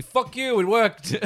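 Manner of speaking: high and loud joking outburst; mock outrage. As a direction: yell it at the designer.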